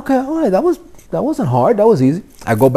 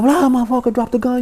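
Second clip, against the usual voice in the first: higher, hammed-up tough-guy threat; raised-pitch mock threat at a hostage; mock audition yelling; 12 semitones higher than usual. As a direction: yell it like a cop-movie thug. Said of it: "I'm screaming obscenities".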